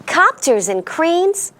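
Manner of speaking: plain reading voice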